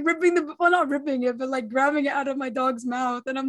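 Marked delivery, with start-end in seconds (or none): none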